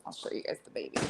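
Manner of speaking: hushed whisper near sleeping kittens; breathy whisper so they stay asleep; whispering over a crib. Real, not acted.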